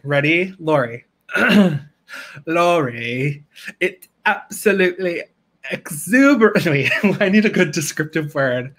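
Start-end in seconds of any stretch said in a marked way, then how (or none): 2.5-6.7 drawn-out, affected diva address; Moira Rose. Acted. Impression performed for Lori, affected diva voice. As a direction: stretch the name, then rise into theatrical grandeur. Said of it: "this is for Lori".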